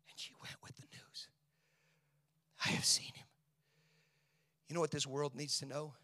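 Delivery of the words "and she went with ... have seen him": whisper. Acted. Hushed telling of the news.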